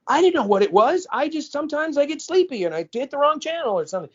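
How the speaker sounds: put-on high, falsely innocent little-kid excuse. Excuse to parents after busted.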